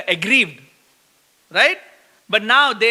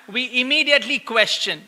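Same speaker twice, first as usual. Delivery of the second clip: usual speaking voice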